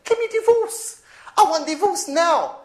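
high, insistent wife's voice, slowing on the second line; wife demanding divorce. Retold or imitated. Husband retelling his wife, high and insistent. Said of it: "she'll turn around and say".

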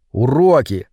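usual speaking voice

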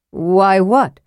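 usual speaking voice

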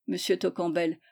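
ordinary delivery, nothing marked

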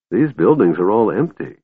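ordinary delivery

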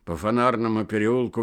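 plain reading voice

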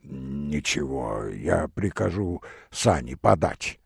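low, unhurried, a touch husky; hoarse male voice. Acted. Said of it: "говорил сиплый голос". (негромкий сипловатый мужской голос)

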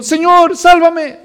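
loud, high cry of a sinking man; desperate cry for help. Retold or imitated. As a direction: cry out to be saved. Pedro se hunde y grita. (grito desesperado de auxilio)

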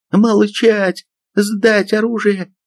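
very high-pitched squeal of an order; shrieked command. A narrator's reading. Sergeant cuts off soldier to disarm men, shrieking. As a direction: squeal it, dragging the last order. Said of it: "взвизгнула Шведова".

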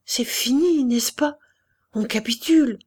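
a sick man clutching at hope, trembling; fever-trembling voice. Acted. Feverish colonel asks his visitor about defeat, trembling high voice. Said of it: "d'une voix que la fièvre faisait trembler".

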